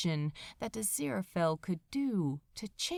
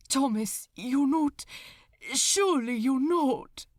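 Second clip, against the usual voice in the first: raised, cracking disbelief; anguished plea; 4 semitones higher, 5 dB louder than usual. Acted. Angel begs his friend, loud anguished disbelief. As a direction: pitch up and push, refusing to believe it.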